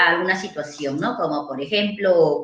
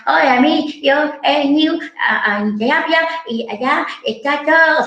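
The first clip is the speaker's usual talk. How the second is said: put-on chatty little girl; 6 semitones higher than usual. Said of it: "Empieza, ¿no?".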